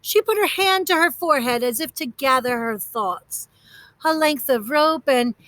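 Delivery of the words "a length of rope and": ordinary delivery, nothing marked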